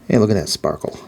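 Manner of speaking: ordinary delivery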